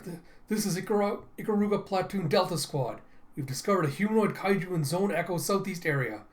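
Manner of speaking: acted alarmed squad member; urgent radio report.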